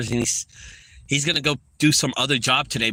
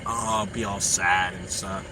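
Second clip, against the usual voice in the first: mocking sad son; 6 semitones lower, 4 dB quieter, slower than usual. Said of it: "disappointing to hear my son's voice".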